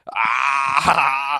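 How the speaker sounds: a loud, high burst of delight; delighted shout. Real, not acted. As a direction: whoop at the punchline. Reacting to the punchline.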